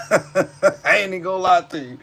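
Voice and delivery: said through a laugh. Real, not acted. Streamer laughing about old school Reggie. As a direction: chuckle it out fondly.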